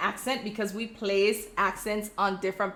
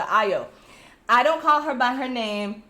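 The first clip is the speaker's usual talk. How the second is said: ordinary talk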